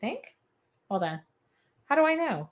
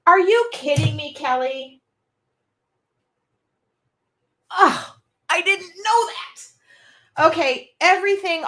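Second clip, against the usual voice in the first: shocked disbelief; 9 semitones higher, 7 dB louder than usual. Real, not acted. Shocked by viewer Kelly's comment.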